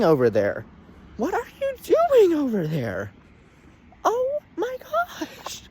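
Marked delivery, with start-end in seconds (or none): 1.2-3.1 much higher, squeaky repeat of the question; hammed-up high-pitched scolding; exasperated squeal. Reviewer asks Disney what they're doing, exasperated squeal. 4.0-5.6 very high, slow whimper; softer, drawn-out fake crying; mock despair. Reviewer despairs at Disney's movie, mock whimper.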